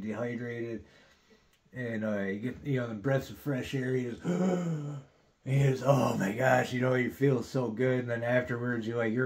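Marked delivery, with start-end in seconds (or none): 4.3-5.0 sharp high gasp of relief; gasp for air. Retold or imitated. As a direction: suck in a breath like surfacing. Gulping fresh air in pit.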